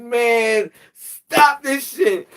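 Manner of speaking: high, slow, whiny plea; a half-crying mock plea to stop; hammed-up plea to the hustlers to quit; exasperated plea. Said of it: "I said".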